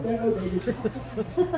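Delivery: soft, high, drawn-out concession; sheepish agreement. Acted. Hushed passenger answering on an airplane, sheepish. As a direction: back down in a small high voice.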